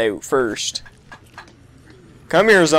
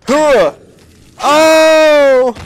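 loud high surprised oh; surprised shout; 11 semitones higher, 7 dB louder than usual. Real, not acted. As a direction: gasp it out in awe. Reacting to kill animation.

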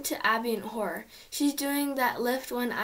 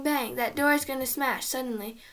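ordinary delivery

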